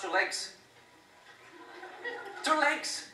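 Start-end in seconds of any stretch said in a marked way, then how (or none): 2.4-3.1 high, loud disbelief; incredulous. Acted. Comic to the crowd about Six Flags riders, high disbelief. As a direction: act baffled that legs just come off.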